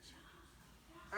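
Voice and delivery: whispered good job; hushed encouragement to the class; whispered praise. Real, not acted. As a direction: breathe the praise softly. Praising kids quietly.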